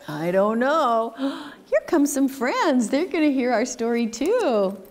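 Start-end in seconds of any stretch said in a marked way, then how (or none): none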